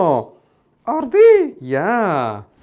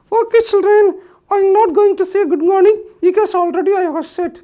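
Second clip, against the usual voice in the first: Mickey puppet voice; 7 dB louder than usual. Acted. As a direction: greet the kids like a bouncy puppet. Puppet Mickey addresses children.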